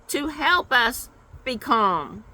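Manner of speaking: usual speaking voice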